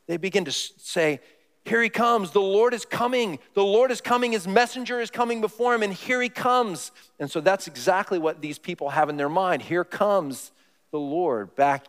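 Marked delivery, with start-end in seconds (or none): 1.6-6.9 high, excited proclaiming call; watchmen shouting. Retold or imitated. Watchmen on the wall announcing the Lord, high excited call. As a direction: cry out the news from the wall. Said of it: "they begin to shout".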